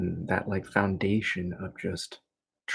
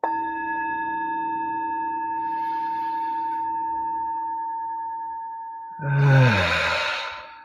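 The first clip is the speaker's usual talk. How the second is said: long inhale, then big audible exhale; big sigh of relief; 6 semitones higher than usual. Shown as a demonstration. Teacher breathing along with the class, big relieved sigh. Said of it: "we wanna take a big sigh of relief".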